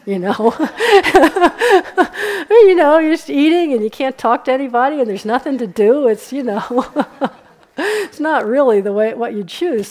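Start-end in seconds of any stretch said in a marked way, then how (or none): 0.6-2.4 high, loud burst of real laughter. Real, not acted. Laughing at how unbearable retreat life sounds. 2.5-7.3 words pushed out through laughter, higher and louder; laughing while talking. Real, not acted. Teacher laughing to retreatants about silent meals. 7.8-9.9 laughing. Real, not acted. Still laughing.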